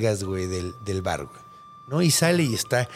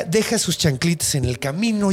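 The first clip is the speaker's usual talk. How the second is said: ordinary delivery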